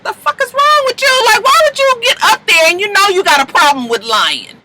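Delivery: high-pitched, outraged mock scolding; indignant scolding. Kids confronting a friend about lying on the stand, indignant.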